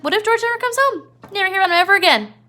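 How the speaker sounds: high, fast taunting voice; Crooks taunting. Retold or imitated. Crooks scaring Lenny about George, high and fast. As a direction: needle him with a high rushing taunt. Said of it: "He's like".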